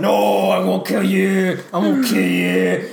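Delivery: slow, drawn-out dopey voice; mock hero vow. Friend mocking the fight dialogue, slow dopey vow. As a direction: drag each word out heroically.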